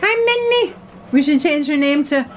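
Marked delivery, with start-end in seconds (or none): 0.0-0.8 high sing-song pet voice. Real, not acted. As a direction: coo at the puppy way up high. Greeting the dog.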